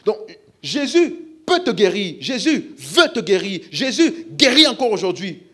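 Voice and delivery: loud, high, laughing proclamation; full-force preaching. Real, not acted. Pastor proclaiming healing to the church, loud and high. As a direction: proclaim it with joy and power.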